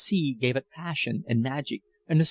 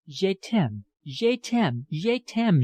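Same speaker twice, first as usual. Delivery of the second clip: put-on gushing French accent, each "je t'aime" climbing higher; extravagant French accent; 6 semitones higher, much slower than usual. A narrator's reading. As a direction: pour out "je t'aime" three times in a showy French accent, rising each time. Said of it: "with her extravagantly French accent".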